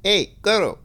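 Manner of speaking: high, loud mock child voice; adult faking a kid's voice; badly dubbed kid. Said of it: "an adult trying to sound like a kid".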